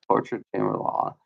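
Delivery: whispered under his breath; whispering the phrase to himself. Real, not acted.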